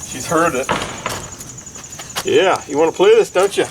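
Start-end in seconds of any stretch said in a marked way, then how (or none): 2.2-3.7 coaxing dog voice. Real, not acted.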